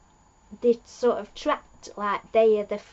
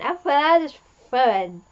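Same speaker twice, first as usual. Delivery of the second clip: ordinary delivery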